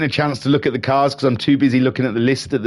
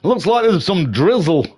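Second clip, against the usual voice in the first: excited race commentary; 8 semitones higher than usual. Acted. Excited race call resumes.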